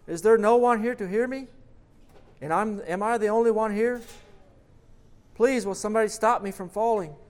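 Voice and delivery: high, loud desperate calls; shouting. A narrator's reading. The falling man calling out for anyone in the darkness, loud and high. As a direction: shout into the empty dark. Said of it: "the man shouts into the darkness".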